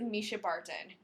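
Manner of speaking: ordinary talk, nothing marked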